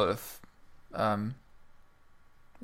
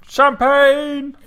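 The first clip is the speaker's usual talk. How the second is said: excited shout; 13 semitones higher, 10 dB louder than usual. Real, not acted. Celebrating halfway point.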